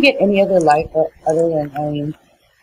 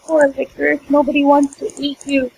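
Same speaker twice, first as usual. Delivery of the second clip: ranting; 6 semitones higher than usual. Real, not acted. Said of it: "You're ranting right now".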